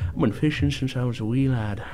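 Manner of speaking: a hint of an old Scottish sea dog; a faint pirate brogue; vague Scottish-pirate accent. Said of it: "I don't know what accent that was".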